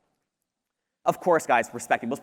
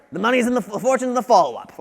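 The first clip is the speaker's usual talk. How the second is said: higher, louder, badly faked accent; terrible Grant Cardone accent; 7 semitones higher, 7 dB louder than usual. Speaker quotes a sales guru to the audience, terrible put-on accent. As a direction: put on a bad accent for his catchphrase. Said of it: "That was a terrible accent".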